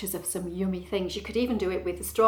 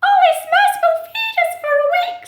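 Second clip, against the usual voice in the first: high, loud mouse squeal; tiny thrilled mouse voice; squeaky mouse; 14 dB louder than usual. A narrator's reading. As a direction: squeak it excitedly like a storybook mouse. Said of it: "squeaked Lizzie".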